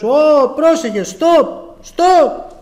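high, loud cry of alarm; shouted warning. Acted. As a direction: call out sharply to stop someone before a crash. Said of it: "φωνάζουμε". (δυνατή προειδοποιητική φωνή)

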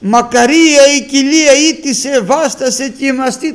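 loud, high cry from the crowd; a woman lifting her voice above the crowd. Retold or imitated. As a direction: call out from the crowd in praise. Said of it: "σηκώνει τη φωνή της και φωνάζει".

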